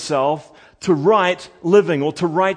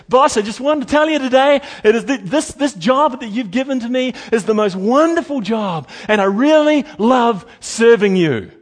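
eager gushing to the boss; 8 semitones higher, 7 dB louder than usual. Pretend.

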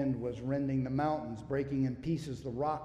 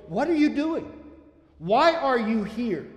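with this flavor; 9 semitones higher, 9 dB louder than usual. Shown as a demonstration. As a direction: press him with the question, the second part slowed. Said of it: "this flavor".

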